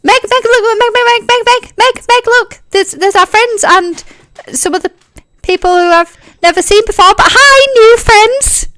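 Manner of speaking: very high, rapid calling of Meg; excited Tyne. Acted. Tyne pesters Meg about their new friends, high and eager. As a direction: tug at Meg's sleeve with your voice.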